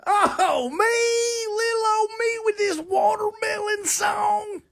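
very high, crying mock falsetto; hammed-up country diva. Host camping it up as a shy country star. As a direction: wail it like a flustered starlet.

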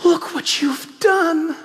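whispered opening, then high, slow mock horror; a villain feigning shock at the boy; fake-dismayed whisper rising to a high, slow accusation; Scar. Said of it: "Scar turns right then and there and says".

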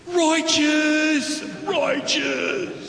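high, stretched-out surfer dude. Acted. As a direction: call it out like a stoked surfer. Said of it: "in his best surfing voice ever".

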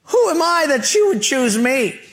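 high-pitched incredulous protest; Moses. Acted. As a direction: play a reluctant prophet, voice jumping up in disbelief. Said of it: "You know what Moses' answer was?".

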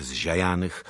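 plain reading voice

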